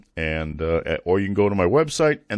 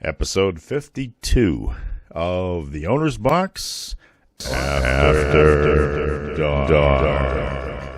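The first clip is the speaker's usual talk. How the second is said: slow announcer read drenched in reverb; reverb echo; 4 semitones lower, much slower than usual. Real, not acted. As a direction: intro read with echoing reverb. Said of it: "the microphone is still reverberating".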